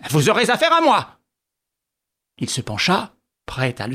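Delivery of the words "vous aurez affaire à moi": furious growl. A narrator's reading. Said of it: "gronda Ganimard furieux".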